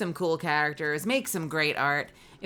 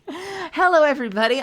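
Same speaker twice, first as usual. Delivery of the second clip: much higher and louder hello; excited hello; 11 semitones higher, 11 dB louder than usual. Real, not acted. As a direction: welcome the raiders with delight. Greeting raiders.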